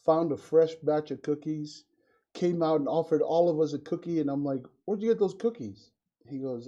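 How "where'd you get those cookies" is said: suspicious parent. Retold or imitated. Father questions his son.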